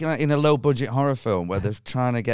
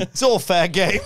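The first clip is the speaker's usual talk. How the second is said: higher, louder mimicry of a precocious lad; grown-up-talking toddler; 8 semitones higher, 5 dB louder, slower than usual. Toddler brushing off the comic, lightly mimicked grown-up talk. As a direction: imitate a small kid talking like a bloke. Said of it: "Kids don't talk like this".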